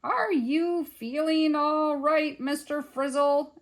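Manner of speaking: slow, high, loud careful question; doubting policeman. A narrator's reading. Police questioning the agitated museum man, slow careful query. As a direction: ask slowly, as if humoring him.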